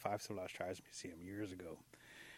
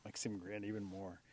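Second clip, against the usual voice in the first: plain reading voice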